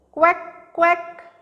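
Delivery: high, cartoonish duck quacking; playful high quack, quack. Shown as a demonstration. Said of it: "And what does duck say?".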